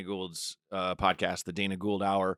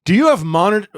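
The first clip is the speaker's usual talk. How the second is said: eager loud question; 8 semitones higher, 10 dB louder than usual. Retold or imitated. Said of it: "just walks in and just goes".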